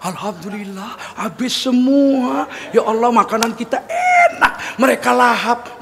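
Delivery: slow, drawn-out mock gratitude; delighted wife, not angry. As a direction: play a grateful cook, stretching every word. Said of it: "dia nggak marah. Dia bilang apa?". (istri bersyukur yang dilebih-lebihkan, pelan)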